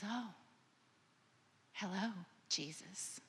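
soft, hushed greeting to a newborn; tender hush. Pretend. Mother greeting her newborn baby, soft and tender. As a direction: breathe it to the baby in your arms.

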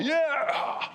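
high excited yeah, hammed up; playful imitation of a fan's yell; mock cheer. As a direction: cheer like a pickleball fanatic. Mimicking pickleball fans' cheer.

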